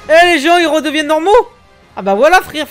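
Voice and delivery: excited. Real, not acted. Loud delighted reaction.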